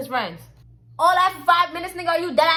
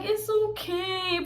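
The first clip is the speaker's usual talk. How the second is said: mock soothing; 3 semitones higher, 4 dB quieter, much slower than usual. Friend comforting him.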